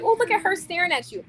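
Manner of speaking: high-pitched playful pointing out; teasing exclamation. Retold or imitated. Woman telling her man a girl is looking, teasing exclamation.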